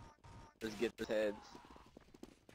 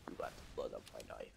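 much lower, rushed growly game voice; low gruff character voice; 13 semitones lower, 8 dB quieter, much faster than usual. Shown as a demonstration. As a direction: drop way down and rattle it off fast. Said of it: "Can't do the voice".

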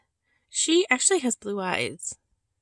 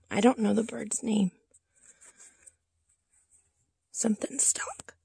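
whispered aside. Real, not acted. Aside while showing the doll.

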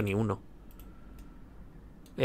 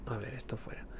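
whispering; 18 dB quieter than usual. Real, not acted. Aside whispered mid-stream.

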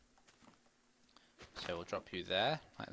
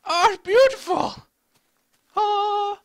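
put-on much higher, louder, slow falsetto old lady cooing; Doris voice; 16 dB louder, slower than usual. Admiring his tidy T build as old lady Doris, high falsetto. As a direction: coo over the build like a doting old lady. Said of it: "Just call me Doris".

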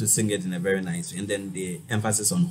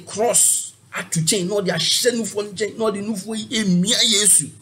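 high, loud mock preacher chant; imitating a prophet; 9 semitones higher, 4 dB louder than usual. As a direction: ham up a fiery prophet in Twi. Lecturer imitates prophetic church preaching.

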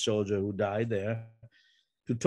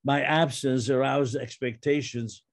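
higher, measured poetry reading voice; poem reading; 3 semitones higher than usual. Acted. Poet reading his poem aloud to the audience, measured and lifted. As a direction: lift the lines slowly, like a recited poem.

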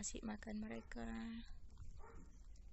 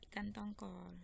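normal talking voice

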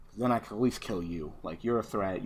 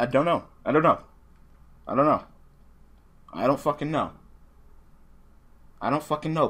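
exasperated; 5 semitones higher, 6 dB louder than usual. Real, not acted. Exasperated repeat.

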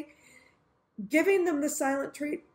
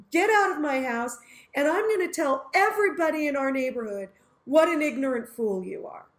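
angry refusal; 2 semitones higher, 5 dB louder than usual. Pretend. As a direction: spit out the refusal and insults. Refusing forgiveness, furious.

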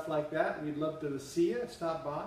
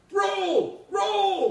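very high, loud coach yell; barking gym coach; 7 dB louder than usual. Pretend. Mock coach shouting at drummer.

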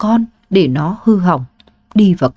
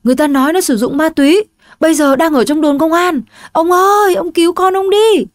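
high, fast pleading; mother begging husband to save their son, high and fast; desperate plea; 9 semitones higher than usual. Acted. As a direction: beg in a rush.

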